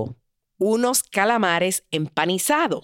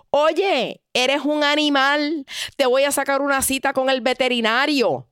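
angry outburst; 7 semitones higher than usual. Retold or imitated. Partner insults the rude man, mock furious. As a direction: tell him off in a rage. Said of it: "le da una rabia".

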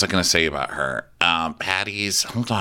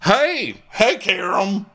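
Southern greeting; 12 semitones higher, much slower than usual. Retold or imitated.

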